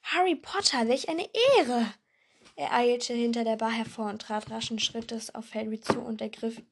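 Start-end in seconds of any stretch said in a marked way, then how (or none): 0.0-1.9 awed exclamation. A narrator's reading. Barman awed at meeting Harry.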